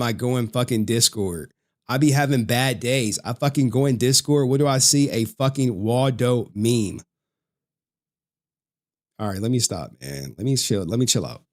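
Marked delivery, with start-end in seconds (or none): none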